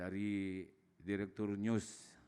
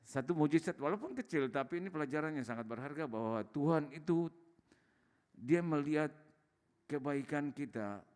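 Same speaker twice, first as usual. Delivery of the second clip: raised, higher preaching voice, building fervour; impassioned preaching; 4 semitones higher than usual. Acted. Testifying to Bapak Ibu about God's goodness, fervent preaching. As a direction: preach it to the congregation with fire.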